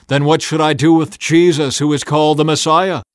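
loud, high address to a crowd. A narrator's reading. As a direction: put the choice loudly to everyone.

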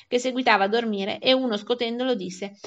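ordinary delivery, nothing marked

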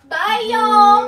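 loud goodbye. Real, not acted. Wife hollering goodbye to the livestream viewers, loud. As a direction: holler bye to everyone.

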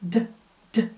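bare D burst, no vowel; pure D sound. Shown as a demonstration. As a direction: voice a D with no vowel after. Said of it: "Tô tentando exagerar". (D puro, sem vogal)

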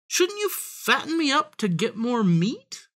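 high-pitched, innocent suggestion; wheedling piglet. A narrator's reading. Piglet talks the fox into feeding him, wheedling. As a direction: coax sweetly, high in the voice.

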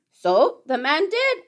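ordinary delivery

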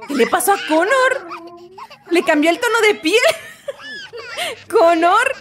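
amused squeals through the words; laughing disbelief. Real, not acted. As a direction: laugh at the odd skin colour. Character's skin colour changed.

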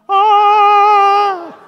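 high loud sung ah; choir-like high ah; angel ah. Shown as a demonstration. Audience prompt during story.

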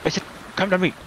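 high, emphatic repeated call; insistent command. Pretend. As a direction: demand it, pitch jumping up.

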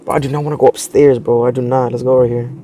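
usual speaking voice

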